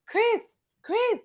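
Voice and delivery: high, gushing flirty coo; hammed-up high flirt voice; imitating Giselle. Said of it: "Giselle go".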